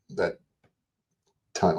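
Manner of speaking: ordinary delivery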